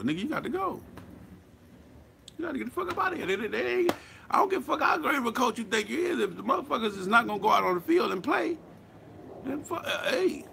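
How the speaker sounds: high, exasperated owner kicking the coach out; fed-up owner. Retold or imitated. Voicing the fed-up team owner.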